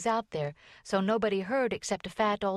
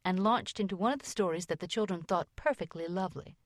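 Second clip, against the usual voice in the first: usual speaking voice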